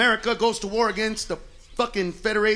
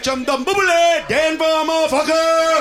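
high, slow, loud mock chant; mock gibberish; 5 semitones higher, 5 dB louder, slower than usual. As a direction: draw it out big and loud. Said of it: "You're gonna be like".

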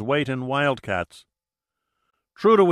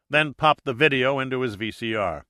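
ordinary delivery

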